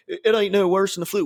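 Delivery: lightly mock a West Virginia yokel; Southern accent. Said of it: "a fucking Southern or West Virginia accent".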